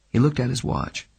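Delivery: ordinary delivery, nothing marked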